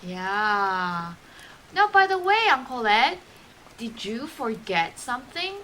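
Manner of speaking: ordinary delivery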